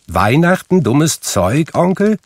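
high, rising, disbelieving question; nephew's high-pitched protest; incredulous echo. A narrator's reading. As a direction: throw it back in cheerful disbelief. Nephew echoes Scrooge in disbelief.

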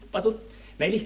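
ordinary delivery

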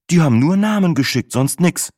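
höher und lauter, leicht unschuldig; Sinas Stimme. A narrator's reading. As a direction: spiel die arglose Freundin Sina. Sina in the story. (Sina antwortet ihrem Freund ganz unschuldig)

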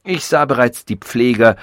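plain reading voice

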